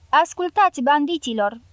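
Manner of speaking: plain reading voice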